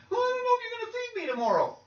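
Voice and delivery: high, whiny mock-crying kid; hammed-up tearful child voice. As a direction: wail it quickly like a tearful little child.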